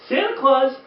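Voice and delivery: ordinary delivery, nothing marked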